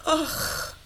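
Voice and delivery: langsam und viel leiser, zärtlich; zärtlich leise. Acted. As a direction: sprich es ihm sanft und liebevoll zu. Zärtliche Versöhnung nach Streit. (Oksana wendet sich zärtlich leise an Boris)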